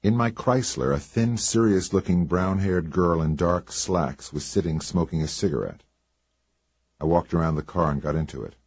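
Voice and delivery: ordinary delivery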